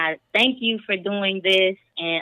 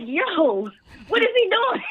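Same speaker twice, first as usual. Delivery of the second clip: shocked yell; 11 semitones higher than usual. Retold or imitated. Caller retelling watching the video, shocked laughing shout. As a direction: yell it like you can't believe what he just did. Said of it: "I was like".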